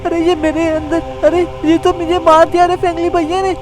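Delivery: high, panicked cries in a nightmare; terrified dream cries. Acted. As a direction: scream for help as if being killed. Nightmare, Shinchan being killed. (सपने में डर से ऊँची चीखें)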